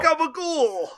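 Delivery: GABAGOOL barked high and loud, New Jersey style; hammed-up New Jersey mob exclamation, gabagool; comic New Jersey Italian gabagool; Italian-American gabagool. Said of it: "babbling like a baby".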